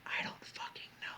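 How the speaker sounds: soft, emphatic whisper; hushed stage whisper; whispered aside. Real, not acted. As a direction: whisper the reminder confidentially.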